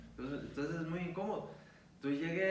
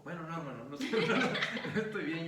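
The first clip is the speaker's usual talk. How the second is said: confessing to the brother he is not fine, laughing louder; laughing admission; 2 semitones higher, 6 dB louder, faster than usual. Retold or imitated. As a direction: admit it through a nervous laugh.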